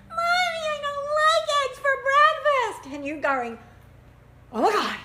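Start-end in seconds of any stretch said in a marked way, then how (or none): 0.1-3.0 put-on high, loud, crying child's whine; Johnny whining. Johnny whining to mommy about eggs, high and teary. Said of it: "here you find Johnny going". 4.5-5.0 mock horror. Parent horrified reaction.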